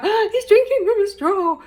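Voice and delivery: high, loud gasp of delight; excited shout. Retold or imitated. Mother at a children's party sees son use straw, excited shout. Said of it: "I just shouted out".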